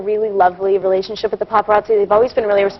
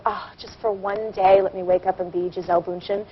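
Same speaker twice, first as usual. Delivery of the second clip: wistful wish; 4 semitones lower, 7 dB quieter than usual. Retold or imitated. Leafing through magazines wishing for a model's looks, soft wistful plea. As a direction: wish it quietly, slowing down. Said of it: "I'm like".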